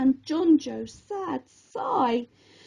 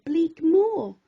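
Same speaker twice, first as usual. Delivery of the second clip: ordinary delivery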